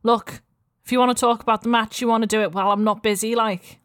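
a light Scouse market-stall mum; lightly voiced Liverpool accent, friendly. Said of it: "while I'm doing a Liverpool accent".

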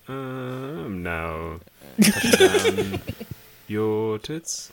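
pilot talking in his sleep; sleepy pilot voice. Pretend. Pilot voice in bed.

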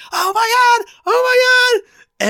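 very high, loud shrieks of horror; screaming. Retold or imitated. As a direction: imitate a sitcom character's shocked scream. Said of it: "She starts screaming".

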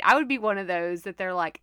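ordinary talk

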